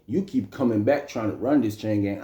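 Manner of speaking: usual speaking voice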